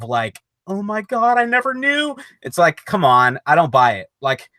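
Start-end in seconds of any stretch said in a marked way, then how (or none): 0.7-2.2 high, rising mock disbelief; mock shock. Friend imitates the heroine hearing the news, mock shocked. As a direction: play the stunned victim, pitched up. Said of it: "got a look of".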